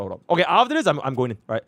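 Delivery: normal talking voice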